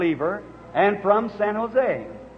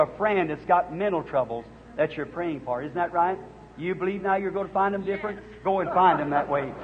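ordinary talk

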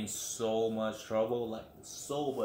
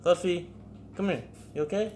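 high, louder coaxing to the pet; pet talk; 8 semitones higher, 3 dB louder than usual. Real, not acted. Driver checking on Fluffy after hitting her. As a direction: coax her over, pitched up.

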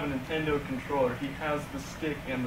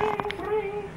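high, far-off ringing phone noise; high mock telephone ring; phone ring imitation.

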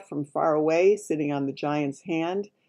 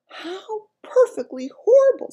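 very high, louder appalled exclamation; horrified; 7 dB louder than usual. A narrator's reading. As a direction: react in horror as a little girl. Sophie reacts in horror.

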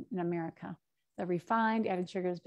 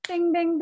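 high, loud game-show bings; bing sound effect; 9 semitones higher, 7 dB louder than usual. Acted. Teacher marking a great swap with high, loud bings. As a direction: ring it like a prize bell. Nuts for chips deal.

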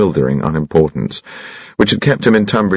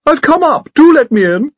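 very high, piping little voice, a bit louder; extraordinarily high-pitched; 4 dB louder than usual. A narrator's reading. Visitor calls through the door to Evelyn, very high piping voice. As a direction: squeak it out like a tiny old gentleman. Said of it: "extraordinarily high-pitched voice".

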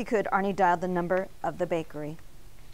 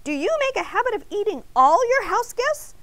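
high, loud indignant protest; scandalized high-pitched rant; shrieking outrage; 14 semitones higher, 6 dB louder than usual. A narrator's reading. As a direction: tell him off, outraged, pitch way up. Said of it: "Arnie shrieked".

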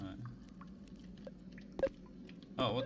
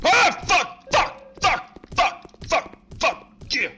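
very high loud frantic cursing; panicked swearing; 12 dB louder, much slower than usual. Real, not acted. Streamer chased by monster, high panicked cursing. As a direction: scream curses in panic.

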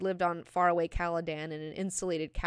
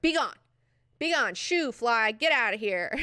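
high, loud shooing; hammed-up royal waving away a pest; mock dismissal; 5 semitones higher, 7 dB louder than usual. Acts out Jessica dismissing him.

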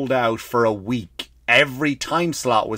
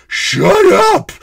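very high, loud screech; hammed-up high-pitched yell; imitated shout; 8 dB louder than usual. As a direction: scream it like a heckler across the theater. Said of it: "all you hear is".

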